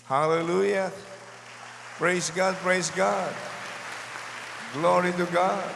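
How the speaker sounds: exclaiming praise from the pulpit; fervent praise. Real, not acted. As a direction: lift the congregation with joy. Preacher praising God aloud.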